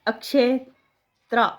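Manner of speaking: अक्षेत्र stretched out slowly; careful spelling-class pace; slow syllable demo. Shown as a demonstration. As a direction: draw out अक्षेत्र piece by piece. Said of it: "spelling हमारी क्या बनेगी".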